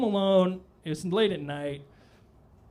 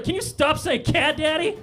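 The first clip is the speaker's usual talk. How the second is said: high, raised exasperated plea; 10 semitones higher, 4 dB louder than usual. Retold or imitated. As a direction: beg him to drop it, half laughing. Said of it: "I was like".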